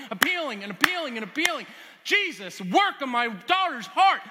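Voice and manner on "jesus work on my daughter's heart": desperate loud plea. Acted. Mother's desperate prayer acted out.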